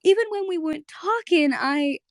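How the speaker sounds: character voice. Retold or imitated. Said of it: "and she goes".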